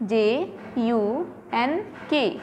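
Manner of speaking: J U N K, very slow letters; J U N K, one letter at a time; slow spelling. Shown as a demonstration. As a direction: spell J U N K with pauses.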